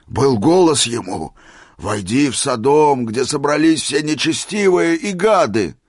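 loud, rising prophetic proclamation; fervent declaiming. A narrator's reading. Old man reporting the voice from heaven, fervent. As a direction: thunder out the command, climbing in pitch.

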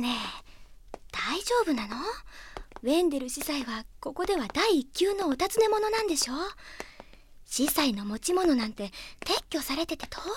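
hushed worried whisper. Acted. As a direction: keep it down in enemy ground. Sneaking inside enemy city.

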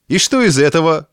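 rising indignant question. A narrator's reading. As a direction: protest it, offended. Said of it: "возмутился хозяин квартиры".

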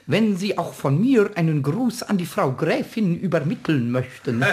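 stilted, over-formal servant parody; mock servant imitation. Kobold mocks the departed servant to the carpenter, pompously formal. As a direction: ape a pompous old servant's stiff courtesy.

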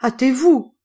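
loud, high exclamation; urgent cry. A narrator's reading. Dying master to his servants at the door, urgent shout. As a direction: cry out to hurry the bearers along. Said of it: "mourant, s'écria".

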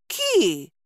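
high protesting squeal; indignant chick. A narrator's reading. Chick protesting at losing its supper, indignant high squeal. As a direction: squeal back like an offended baby bird. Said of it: "возмутился птенец".

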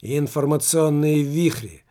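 loud, drawn-out proclamation; enthusiastic exclamation. A narrator's reading. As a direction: exclaim it slowly and forcefully. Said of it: "воскликнул Немаркетинг".

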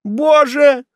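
high, loud outcry; horrified cry. A narrator's reading. Condemned man learns of the executioner, horrified cry. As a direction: cry out in dread. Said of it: "вскричал Муздаман".

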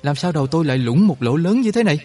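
terrified soldier begging for a nurse; panicked shout. A narrator's reading. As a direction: scream in alarm at a head wound. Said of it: "giật mình sờ tay lên trán và la lớn".

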